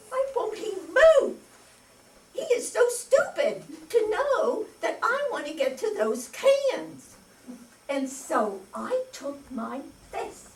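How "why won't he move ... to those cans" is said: frustrated inner rant. Retold or imitated. Woman fuming at man blocking the cans, high and exasperated. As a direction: fume at the man who won't move, pitched high.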